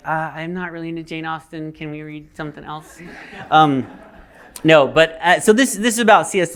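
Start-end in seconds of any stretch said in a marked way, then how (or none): 0.1-3.0 sheepish, softly trailing mumble. Acted. Boyfriend dodging his girlfriend's book, sheepish. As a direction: quietly beg off, trailing away.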